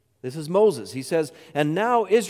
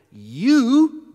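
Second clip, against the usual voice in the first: "You," thrown out high and loud; a loud, pointed "You,"; emphatic you; 5 semitones higher, 7 dB louder than usual. Shown as a demonstration. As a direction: jab the word "You," at him like an accusation. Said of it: "it's very emphatic".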